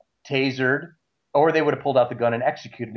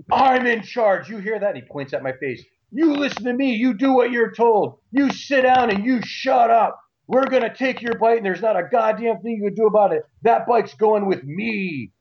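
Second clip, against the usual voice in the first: jab every word like a petty tyrant; abusive cop tirade; 9 semitones higher than usual. Retold or imitated. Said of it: "every archetypal, stereotypical, abusive thing".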